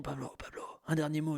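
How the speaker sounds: hushed whisper at a deathbed; whispered plea at a deathbed. Pretend. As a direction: whisper softly, as if beside a dying man. Imagined deathbed, family whispering.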